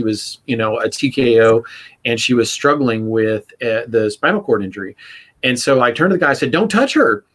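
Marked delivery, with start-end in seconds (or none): 6.5-7.2 higher, sharp snap of an order; blurted warning to a bystander; sharp command. Retold or imitated. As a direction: stop him cold with a quick order. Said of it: "I made it worse".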